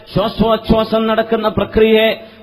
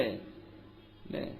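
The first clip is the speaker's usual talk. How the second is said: breathless whispered aside; 13 semitones lower, 27 dB quieter, much slower than usual. Real, not acted. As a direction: whisper the question. Whispered rhetorical question. (മന്ത്രിക്കുന്ന ചോദ്യം)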